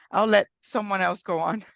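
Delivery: ordinary talk, nothing marked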